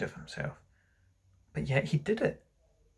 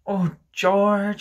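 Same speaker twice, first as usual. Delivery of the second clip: dismayed wife; 7 semitones higher, 5 dB louder than usual. Acted. Wife discovers the trick.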